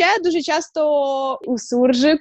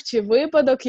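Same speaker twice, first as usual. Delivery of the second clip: ordinary talk, nothing marked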